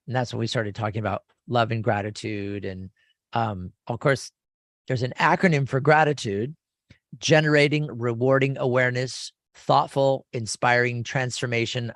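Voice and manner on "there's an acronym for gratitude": ordinary delivery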